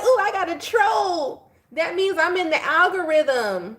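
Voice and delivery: high, slow, delighted squeal; gleeful mock delight. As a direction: gloat over getting a troll, high and drawn out. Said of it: "I'm like".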